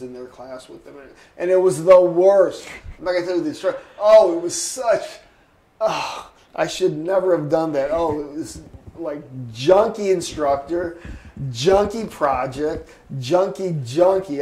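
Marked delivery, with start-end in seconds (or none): 1.4-2.7 loud, emphatic disgust; exasperated. Real, not acted. Student recalling a hated class, loud exasperated venting. 4.0-14.4 loud, emphatic exasperation; disgusted venting. Real, not acted. Former student venting about a bad instructor, loud disgusted emphasis.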